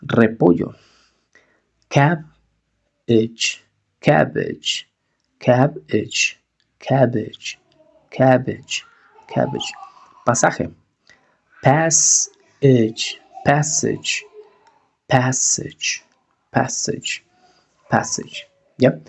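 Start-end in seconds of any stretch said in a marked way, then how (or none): 1.9-9.7 "Cabbage" said cab-ech, second a as short e. Shown as a demonstration. Teacher drilling vocabulary, a as short e. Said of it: "Suena E". 11.6-18.5 "Passage" said pas-ech, second a as short e. Shown as a demonstration. Teacher drilling vocabulary, a as short e. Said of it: "Suena E".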